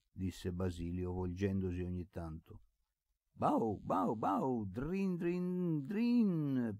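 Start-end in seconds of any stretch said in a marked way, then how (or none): 3.3-6.8 high barking and jingling imitation; mocking bark. A narrator's reading. Boy teasing the dog with barks and jingles, mocking. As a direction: taunt with barks and ringing bell noises. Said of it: "irritato dalle smorfie e dalle grida di Basilio".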